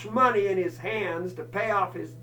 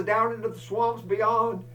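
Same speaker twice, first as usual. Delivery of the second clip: ordinary talk, nothing marked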